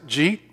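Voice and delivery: "jeet" as one Southern syllable; run together. Shown as a demonstration. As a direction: mash "did you eat" into "jeet". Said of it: "words that we sort of do that with".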